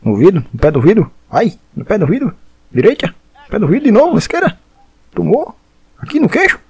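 shouting hits, pitch climbing; excited shouting. Real, not acted. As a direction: hype yelling at the screen. Cheering punches in boxing game.

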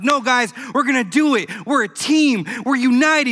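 rally the team, upbeat; encouraging. Retold or imitated. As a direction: coach cheering up his players. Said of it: "the coach is trying to encourage him".

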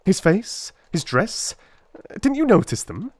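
impatient. A narrator's reading. Holmes breaks in on the rambling constable, impatient. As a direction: cut in, short of patience. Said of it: "broke in impatiently".

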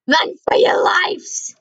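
slow, drawn-out mock panic shout; panicked. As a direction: stretch it out like fleeing in terror. Panicked reading of the game's warning.

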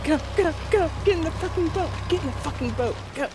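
shrieking in high panic; frantic high cries; scared horror game streamer. Real, not acted. Fleeing in a boat.

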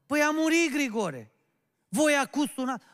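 loud, high-pitched acted outrage; indignant rebuke. Woman on the phone rebuking the late-calling pastor, indignant. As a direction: throw the news back as a reproach.

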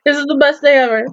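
usual speaking voice

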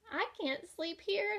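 high little-bug voice; the ladybug fussing on the crocodile's tail, high pitched; squeaky ladybug. A narrator's reading. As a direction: pipe up like a tiny ladybug. Ladybug character voice.

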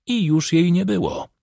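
ordinary delivery, nothing marked

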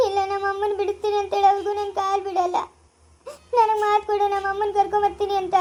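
crying, insistent plea; tearful insistence. Acted. Daughter clinging to his feet, demanding a promise, crying. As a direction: beg for a promise through tears. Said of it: "ನೀನ್ ಯಾಕೆ ಇಷ್ಟೊಂದ್ ಅಳ್ತಾ ಇದೀಯ".

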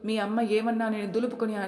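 ordinary delivery

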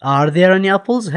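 slow, clear classroom English; slow model reading. Shown as a demonstration. Teacher reads the question slowly to pupils, deliberate and clear. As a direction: read it slowly so pupils can follow.

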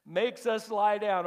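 high, loud, slow declaration; full-force preaching. Acted. Pastor building to the climax of the sermon, preaching at full force. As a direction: preach it out to the back row.

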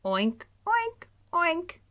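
loud oinks rising high; playful muddy oinks; pigs oinking. Acted. Pigs playing in mud, loud oinks.

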